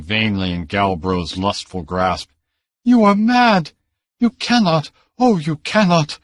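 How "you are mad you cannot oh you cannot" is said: much higher and loud, frantic; desperate plea. A narrator's reading. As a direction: beg him to stop, voice up high. Niece pleading with the uncle.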